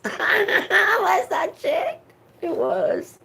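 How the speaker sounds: high, slow and laughing through it. Real, not acted. Telling viewers her heart still beats, laughing high. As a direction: let the laugh break the words up.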